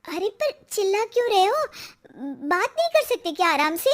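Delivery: high, indignant pushback; annoyed protest. Acted. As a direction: object sharply to being shouted at. Wife protests being shouted at.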